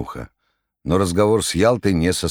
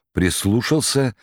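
plain reading voice

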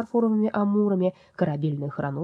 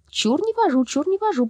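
high, chanting repeat; eager echo; 13 semitones higher, 3 dB louder than usual. Acted. Paper soul chiming in on the game, high and childish. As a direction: chime in like a child. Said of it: "подхватила бумажная душа".

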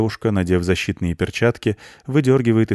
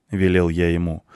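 ordinary delivery, nothing marked

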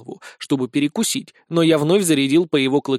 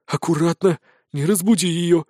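mild cautious warning; wary. A narrator's reading. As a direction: warn carefully, on guard. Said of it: "настороженно произнёс Спирт". (настороженно)

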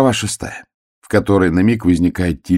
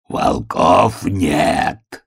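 slow, drawn-out wolf growl; страшный рык; much slower than usual. A narrator's reading. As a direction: growl each word menacingly. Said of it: "страшно зарычал волк". (страшное протяжное рычание волка)

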